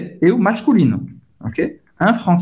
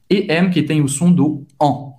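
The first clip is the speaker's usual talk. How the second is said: ordinary delivery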